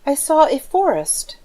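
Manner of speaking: put-on a small child answering shyly; child's reply.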